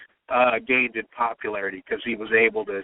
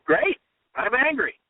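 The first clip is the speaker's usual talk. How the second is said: defiant quote; 10 semitones higher than usual. Retold or imitated. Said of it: "and he said".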